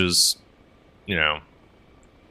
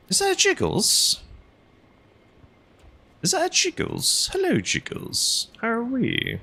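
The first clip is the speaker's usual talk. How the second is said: squeaky-high falsetto greeting; falsetto cooing. Pretend. Streamer greeting Jiggles, playful falsetto coo.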